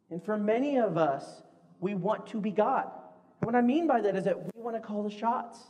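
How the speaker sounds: usual speaking voice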